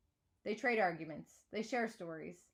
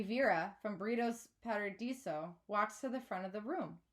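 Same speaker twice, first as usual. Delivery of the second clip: plain reading voice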